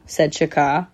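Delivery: plain reading voice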